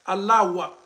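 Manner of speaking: "Allah wa" with a "wa" sound instead of "hu"; wrong pronunciation demo. Shown as a demonstration. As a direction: say "Allah wa", the faulty way. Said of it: "On dit pas wa".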